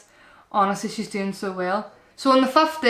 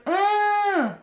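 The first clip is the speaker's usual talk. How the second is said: put-on toddler's call; 10 semitones higher than usual. Toddler signalling she needs to pee.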